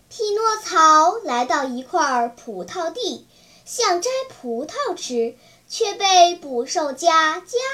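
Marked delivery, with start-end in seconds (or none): none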